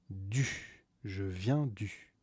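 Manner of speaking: ordinary delivery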